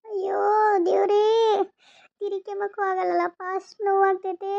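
crying out to God through the pain. Acted. Bedridden mother cries in pain before her son, tearful. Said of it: "ಯಾಕಮ್ಮ ಅಳ್ತಿದ್ಯಾ?".

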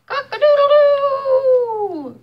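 high, loud, slow rooster crow. Acted. Reading to a child, a full rooster crow. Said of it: "crowed Rooster".

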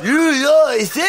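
high mock cowboy cry; hammed-up cowboy disbelief; commercial cowboy holler. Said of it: "all the fucking cowboys".

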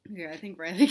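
ordinary delivery, nothing marked

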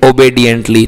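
"ओबीडिएंटली" as "o-bay-dient-lee"; correct obediently. Shown as a demonstration. Teacher confirming the right pronunciation, "ओबीडिएंटली" with "bay" in it. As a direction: put a "bay" sound second in "ओबीडिएंटली". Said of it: "आपका pronunciation सही है".

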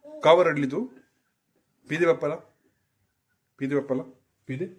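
put-on high, coaxing baby talk to a cat; pet talk. Owner coaxing the cat Mau, high baby talk. Asking the cat why it cried.

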